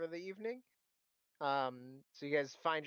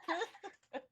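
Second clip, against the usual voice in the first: shocked squeak. Acted. Shocked NPC reaction.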